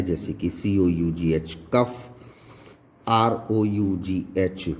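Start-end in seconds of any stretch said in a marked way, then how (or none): 1.7-2.1 कफ with final GH said as F. Shown as a demonstration. Teacher showing how final GH sounds in cough, F sound. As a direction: end कफ on an F sound. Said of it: "ये फा की sound देता है".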